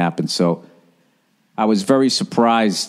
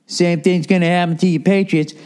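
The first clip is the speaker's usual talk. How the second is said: higher, jeering Twitter troll; mock taunting fan; 7 semitones higher than usual. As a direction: needle him like a smug rival fan.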